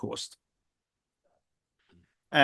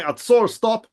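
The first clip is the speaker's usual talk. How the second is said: higher, louder mock order; guard's challenge; 6 semitones higher, 5 dB louder, slower than usual. Guard halting someone who keeps coming, mock call. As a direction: call out a halt like a sentry.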